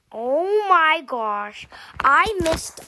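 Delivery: loud, slow, upset cry of dismay; frustrated outburst. Real, not acted. Kid missing a checkpoint jump, loud dismayed outburst. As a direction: wail at the missed jump.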